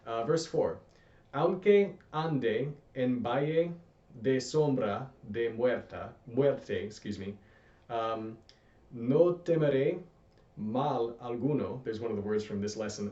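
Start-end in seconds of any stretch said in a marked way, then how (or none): 1.3-6.8 slow, clear Spanish reading; teacher reading verse four slowly for students. Shown as a demonstration. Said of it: "I'm gonna read this slowly". 8.9-11.8 slow, clear Spanish reading; teacher reading slowly for students to repeat. Shown as a demonstration. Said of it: "I'm gonna read this slowly".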